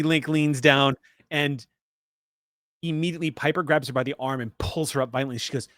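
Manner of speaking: ordinary talk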